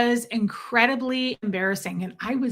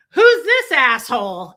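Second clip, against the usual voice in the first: loud gripe; 3 semitones higher, 10 dB louder than usual. Retold or imitated. Recalling loud rudeness.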